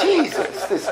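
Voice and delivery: high amused exasperation; laughing. Real, not acted. Laughing about his sponsor, high and amused. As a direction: laugh then sigh out his name.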